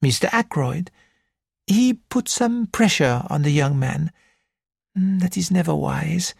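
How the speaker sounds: put-on high, lilting French-accented gentleman; a fussy Belgian detective, high and precise; French accented little detective. Porrett speaks.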